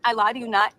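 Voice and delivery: usual speaking voice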